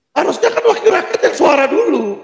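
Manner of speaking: high-pitched exasperated protest; indignant protest. Real, not acted. Indignant complaint about silent lawmakers. (protes kesal bernada tinggi)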